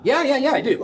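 put-on high eager drunk caller; quick, high, eager yeses; a tipsy man hurrying to agree.

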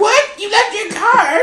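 hammed-up high surprise; mock gasping shock. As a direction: act scandalized about the car. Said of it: "She's like".

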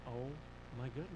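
soft, broken-up murmur; hushed shock. Retold or imitated. Child hearing the news in the car, hushed shock. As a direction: say it under your breath, stunned. Child stunned while overhearing.

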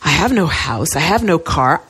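ordinary delivery

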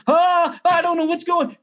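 high-pitched panicked cry, retold; hammed-up high wail of confusion; mock panic. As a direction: act out helpless flailing alarm. Acting out own panic in audition.

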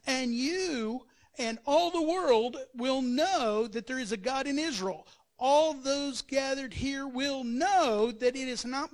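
loud and drawn out, every word weighed; David answering Goliath with God's name; slow proclamation. Acted. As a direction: declare it to the whole army.

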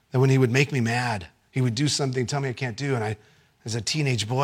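usual speaking voice

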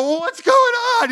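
about to burst from nerves; bursting suspense. Retold or imitated. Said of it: "I'm, like, gonna burst".

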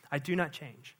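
quiet echo. Acted. Preacher repeating God's words to the church, hushed. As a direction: let it settle in a hush.

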